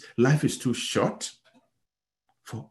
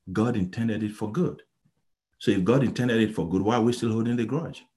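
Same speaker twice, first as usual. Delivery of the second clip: ordinary talk, nothing marked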